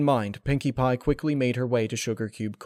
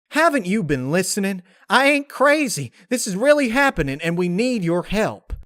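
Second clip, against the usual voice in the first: much higher, louder, desperate pleading; Applejack pleading; 10 semitones higher, 8 dB louder than usual. Acted. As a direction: beg him to listen, voice climbing. Narrator voices desperate Applejack.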